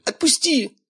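squealing plea to let go; high pleading yelp. A narrator's reading. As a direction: shriek it high in pain. Twisted arm, begging release. (высокий жалобный визг)

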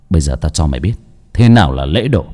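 low, fast threat; menacing. Acted. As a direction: growl out a quick threat. Master threatens the ghost.

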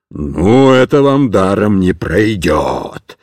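deep, slow warning; threatening sergeant. A narrator's reading. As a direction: wag a finger with your voice. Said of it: "сказал вахмистр, погрозив пальцем".

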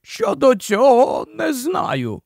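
high, slow, odd marsh-wiggle voice; drawn-out, doleful creature of the marshes; gloomy marsh-wiggle. A narrator's reading. Puddleglum contradicts Jill, slow high gloomy marsh-wiggle voice.

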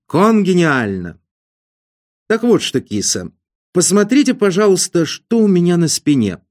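lively great schemer. Acted. Ostap's figure in dialogue.

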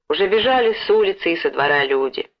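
ordinary delivery, nothing marked

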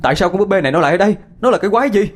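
high, fast demanding shout; frantic yelling. Acted. Sơn shouts at the class, high and fast, demanding who brought the doll. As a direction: demand answers from the group. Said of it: "Cả đám nghe tôi quát".

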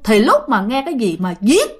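plain reading voice

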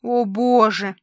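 a narrator's hammed-up "heavens"; theatrical exasperation. A narrator's reading. Papa rolls his eyes at his daughter, theatrically exasperated.

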